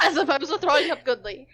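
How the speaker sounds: chuckling through the repeated line; laughing. Real, not acted. Narrator echoing his friend's joke, laughing. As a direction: echo the joke while laughing.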